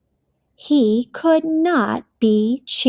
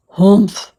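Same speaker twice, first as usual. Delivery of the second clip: low, louder huff; grumpy harrumph; 5 semitones lower, 5 dB louder than usual. A narrator's reading. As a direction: harrumph like a sulky bear. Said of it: "Bear harumphed".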